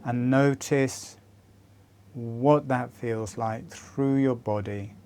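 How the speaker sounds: gentle fading meditation guide; guided meditation. Acted. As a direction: guide them into calm with long pauses. Leads a meditation.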